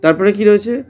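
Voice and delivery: normal talking voice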